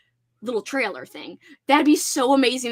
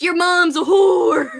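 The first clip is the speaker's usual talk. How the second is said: loud, slow, eager shout; higher, louder, bursting with excitement; all excited; 6 semitones higher, 9 dB louder, slower than usual. Shown as a demonstration. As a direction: read it with an exclamation point. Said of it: "all excited with an exclamation point".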